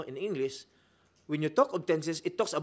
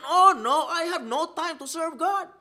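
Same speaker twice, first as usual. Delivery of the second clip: mock excuse; 9 semitones higher, 6 dB louder than usual. Preacher mocks lazy churchgoers.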